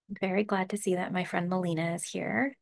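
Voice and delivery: ordinary delivery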